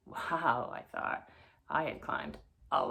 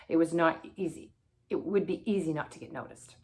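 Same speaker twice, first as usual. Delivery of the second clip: ordinary delivery, nothing marked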